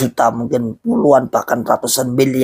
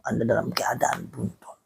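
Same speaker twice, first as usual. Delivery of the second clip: very soft, dropped-away repeat; hushed echo; 12 dB quieter than usual. Real, not acted. Preacher repeating his verdict to followers, hushed. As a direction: let it fall almost to nothing.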